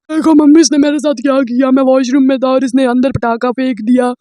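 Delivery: loud, whining complaint to mom; whiny tattling brother. Retold or imitated. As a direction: wail it like a kid telling on his sister. (Mummy से ज़ोर से रोते हुए शिकायत करता भाई)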